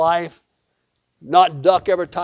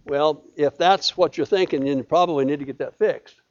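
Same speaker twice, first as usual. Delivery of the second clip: ordinary delivery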